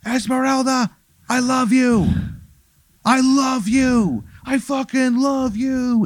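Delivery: high, strained scream at ordinary volume; held-back high wail, increasingly stretched; acted screaming. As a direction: cry out a dying declaration, drawn out. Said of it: "Jay Crow screaming".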